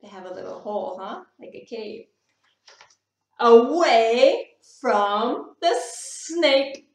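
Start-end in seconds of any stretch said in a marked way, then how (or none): 3.4-6.9 loud and slow; big slow dramatic line; dramatic slow emphasis. A narrator's reading. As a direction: land it like a thrilling reveal. Dramatic story ending.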